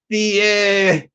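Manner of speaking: ordinary talk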